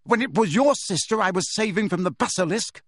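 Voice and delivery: high, accusing retort; heated accusation; rounding angrily. A narrator's reading. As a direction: turn the argument sharply on a friend. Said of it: "he rounded on Ron".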